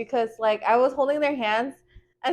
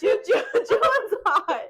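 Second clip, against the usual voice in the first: giggling; 9 semitones higher than usual. Real, not acted. Giggling over the idol.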